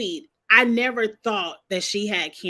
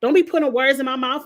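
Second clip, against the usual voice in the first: sassy denial; 5 semitones higher, 3 dB louder than usual. Retold or imitated. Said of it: "And Karen was like".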